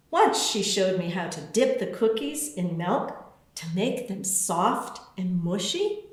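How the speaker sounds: ordinary delivery, nothing marked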